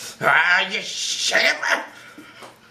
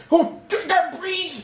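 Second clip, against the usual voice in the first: breathless excitement; 9 semitones higher than usual. Real, not acted. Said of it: "I can't even speak straight right now".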